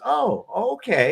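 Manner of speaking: high, slow, drawn-out acceptance; mock pleased surprise. Retold guard answering the joking prisoner, pleasantly surprised. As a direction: act out a guard happily taken in. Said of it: "He goes".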